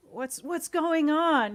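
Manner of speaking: loud, slow, high "what's going on"; mock startled senator. One of us picks up a senator, slow and loud. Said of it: "is like".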